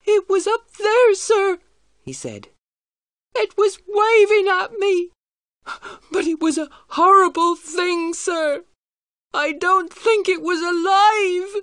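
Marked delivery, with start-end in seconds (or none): 0.1-1.6 high, loud, slow frightened wail; terrified boy crying out. A narrator's reading. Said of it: "He was crying". 3.4-11.5 high, loud, slow frightened wail; terrified boy crying out. A narrator's reading. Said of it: "He was crying".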